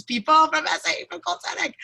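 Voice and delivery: real happy near-tears, voice climbing high; about to cry. Real, not acted. Host seeing people from her former synagogue, about to cry. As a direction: overcome with emotion, pitch rising at the end. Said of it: "like, about to cry".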